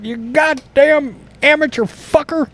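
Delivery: put-on high, odd cranky old-man snarl; grumpy old man. Al Michaels cursing out the vlogger, cranky old-man voice. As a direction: spit it out like a grouchy old-timer. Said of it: "Al Michaels would hear this and be like".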